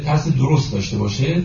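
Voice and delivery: normal talking voice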